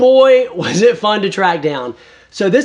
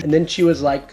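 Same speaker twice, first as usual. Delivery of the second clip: normal talking voice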